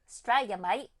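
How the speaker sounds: broad Aussie 'Australia, mate'; exaggerated Australian accent. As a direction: put on a cheeky Australian twang. Shows Australian accent for contrast.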